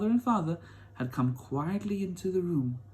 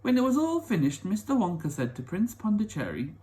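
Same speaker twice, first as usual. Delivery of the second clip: ordinary delivery, nothing marked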